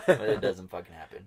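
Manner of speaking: usual speaking voice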